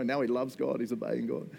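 usual speaking voice

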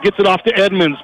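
ordinary delivery

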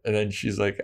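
usual speaking voice

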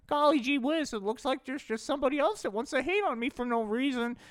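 very high, whiny gee-whiz voice; meek goody voice. Acted. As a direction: sulk like a wronged innocent. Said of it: "Hempy was like".

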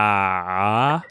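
"Car" low, with a long stretched vowel; stretched Australian vowel. Shown as a demonstration. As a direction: try an Australian "Car", drawing the vowel right out. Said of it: "stretch 'em out".

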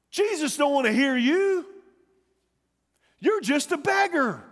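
high-pitched dismissive rebuke; acted scolding, pitch lifted; scolding friends. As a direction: put the beggar in his place. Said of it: "His friends run over and say".

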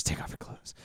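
fully unvoiced whisper. Aladdin secretly telling Jasmine, in a whisper. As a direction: breathe it like a secret aside. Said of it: "he, like, whispers".

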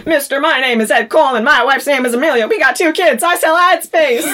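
a man at stakepoint pleading for his life; frantic pleading. Retold or imitated. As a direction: rush out his life story in panic. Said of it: "And he's like".

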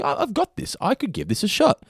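high-pitched mock shower confidence; retold self-confidence. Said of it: "and you're like".

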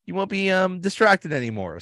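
very high, sugary fake-polite; mock sweet Jim. Jim showing Ryan his new closet office, fake-sweet and very high. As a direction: play it innocent and sing-song high. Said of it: "It's like".

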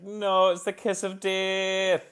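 high, drawn-out mock dread; mock dismay. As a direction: wail it in slow, hammed-up dismay. Acted dismayed reaction to a quote.

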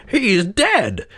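high, cheery announcement; cheerful husband. A narrator's reading. Husband tells wife of creditor's death, cheerfully high. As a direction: break the news happily in a high voice. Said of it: "replied her husband cheerfully".

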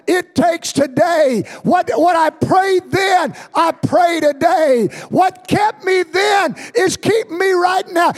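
high, loud shouted sermon; full-force preaching. Acted. Pastor shouts his testimony to the congregation, full force. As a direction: build it up and hammer every line home.

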